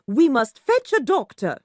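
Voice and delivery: high, loud urgent call; urgent call for help. A narrator's reading. Said Missus Thornton.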